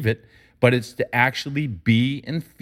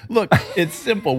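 ordinary talk, nothing marked

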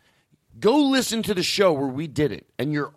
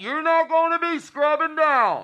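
Doctor Phil; 13 semitones higher than usual. Pretend. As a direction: deliver folksy nonsense wisdom like the TV doctor. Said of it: "impersonation of Doctor Phil".

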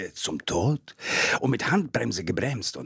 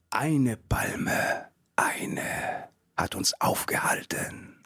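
dramatic whisper; hushed; much slower than usual. Acted. Telling the crash story, a dramatic hushed whisper. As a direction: drop to a breathless hush for suspense.

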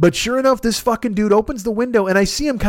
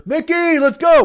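high, raised calling voice; 9 semitones higher, slower than usual. Retold or imitated. Passenger calling his friend back to the car, retold holler.

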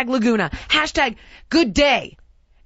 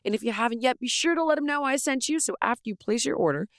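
softer, upbeat sponsor read; 5 semitones lower, 9 dB quieter than usual. Acted. Host reads sponsor ad to listeners, softly. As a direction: sell the underwear warmly.

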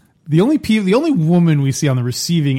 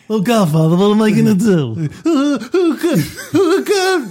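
much higher whiny plea dissolving into laughter; imitating Johnny Fontane; 8 semitones higher than usual. Shown as a demonstration. Host redoes Johnny begging the Godfather, high laughing whine. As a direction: redo the pleading line, cracking up. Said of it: "What was the line again?".

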